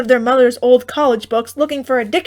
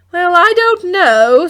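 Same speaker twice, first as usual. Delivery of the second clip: high, louder, slow doubting mother; hesitant mother; 8 semitones higher, 9 dB louder, slower than usual. A narrator's reading. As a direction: drag out the doubt.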